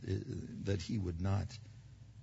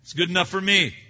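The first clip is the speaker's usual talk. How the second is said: abrupt reply; 6 semitones higher, 7 dB louder than usual. Retold or imitated. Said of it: "rather abruptly".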